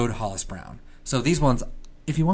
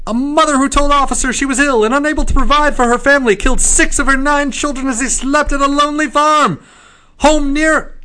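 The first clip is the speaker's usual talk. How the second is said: high, loud newsboy hawking headlines; old-timey paperboy crying the news; newspaper kid; 9 dB louder than usual. Pretend. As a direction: shout the headline like a street newsie. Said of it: "the newsy voice".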